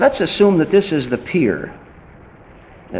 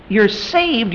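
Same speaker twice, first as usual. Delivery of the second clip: ordinary talk, nothing marked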